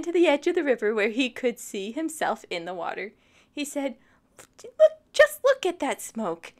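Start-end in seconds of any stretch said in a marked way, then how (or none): 4.6-6.5 excited dragon. Acted. Dexter admiring his smoke.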